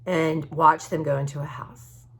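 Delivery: usual speaking voice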